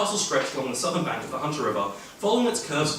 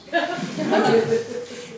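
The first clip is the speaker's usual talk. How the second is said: a high unvoiced giggle that falls; high breathy laugh. Real, not acted. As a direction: giggle at the warning. Laughs after quote.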